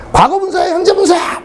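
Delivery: high-pitched either-or challenge; urgent quiz demand. Retold or imitated. Teacher demanding an instant grammar answer, high and urgent. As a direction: throw both options out high. Re-enacts quizzing the class.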